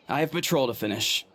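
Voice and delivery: usual speaking voice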